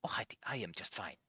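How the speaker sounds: breathy forced whisper, very soft. Acted. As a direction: whisper it as if pretending to be fine. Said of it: "so he sounds forced?".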